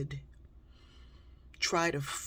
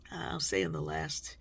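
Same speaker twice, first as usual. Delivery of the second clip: ordinary delivery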